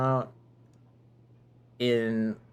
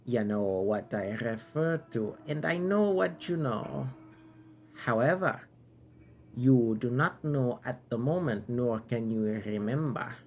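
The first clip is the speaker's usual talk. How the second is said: put-on knight's accent wandering from British to silly French; grand knightly speech in wobbly, changing put-on accents; shifting accents. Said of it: "this Bucket Knight has had four accents".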